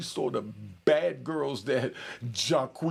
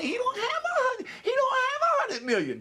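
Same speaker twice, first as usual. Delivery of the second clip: very high, squeaky mimicry; mocking internet trolls; 3 dB louder than usual. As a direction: ridicule the naysayers in a silly high voice. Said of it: "rat bastards and flip-flop wearing in public trolls".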